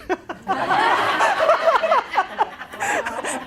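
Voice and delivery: bright burst of real laughter. Real, not acted. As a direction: laugh at your own joke. Joke about no longer deployed.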